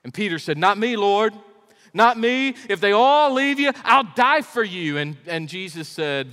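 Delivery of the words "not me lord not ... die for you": higher, louder, pleading protest; passionate vow. Retold or imitated. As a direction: insist you'll never leave him. Peter's vow to Jesus.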